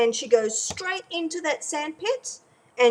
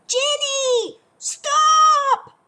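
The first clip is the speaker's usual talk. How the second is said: high, loud panicked shout; mum yells; 9 dB louder, much slower than usual. Retold or imitated. Mum yelling at Jenny near the crossroad, panicked shout. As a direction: scream at the child to stop. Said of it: "So Mum yells".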